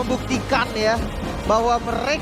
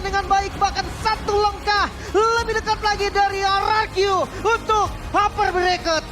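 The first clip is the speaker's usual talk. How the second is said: excited caster; 9 semitones higher than usual. Real, not acted. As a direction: ring out the sweep triumphantly. Co-caster hypes clean sweep.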